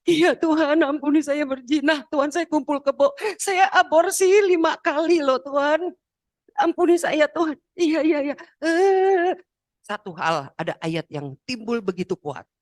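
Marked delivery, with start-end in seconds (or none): 0.1-7.5 loud, high, acted cry of repentance; wailing confession. Acts out confessing prayer to God. 7.8-9.4 loud, high acted wail; wailing assent. Acts out overwhelmed response to God.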